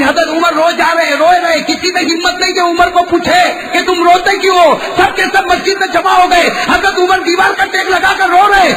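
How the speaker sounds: preacher tells of Umar weeping at the wall; preaching at full force. Acted. Preacher climax.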